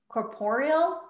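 Corporeal said as a hesitant question; tentative pronunciation. Real, not acted. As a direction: try Corporeal with a questioning rise. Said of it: "trying the name".

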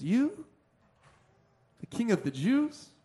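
softly doubting, YOU swept upward, then a pause; incredulous question. Acted. Pilate looks at Jesus, softly incredulous. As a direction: question it in hushed disbelief, rising at the end. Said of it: "asking the question like that".

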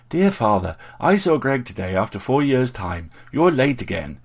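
high, loud mock letter home; mocking recital. A narrator's reading. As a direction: parrot his reproach with theatrical exasperation. Brother mocks him as a letter.

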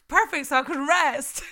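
high, loud, gleeful reaction; retold burst of delight; delighted relief. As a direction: blurt it out like you got lucky, nearly laughing. Retells their own delighted reaction.

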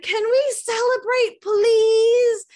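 put-on high, drawn-out begging child; kid pleading. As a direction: beg like an eager little boy. Son begging to celebrate.

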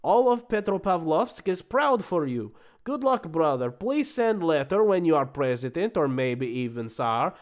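put-on Russian accent, grandly declaiming. Immigrant reviewer recounting his job interview and letters, Russian accent. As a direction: play the boastful Russian immigrant, accent all through. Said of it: "I can do it in an accent".

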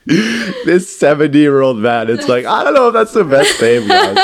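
high, loud, laughing through the words; real laughing fit pushing the voice up; helpless laughter. Real, not acted. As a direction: try to talk while cracking up. Still cracking up at title.

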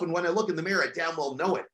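normal talking voice

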